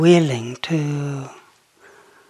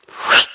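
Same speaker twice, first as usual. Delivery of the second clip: airy whoosh sound effect; a quick rush of air, whoosh; imitated whoosh. Shown as a demonstration. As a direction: imitate something sweeping past. Imitates thought bubble rushing back.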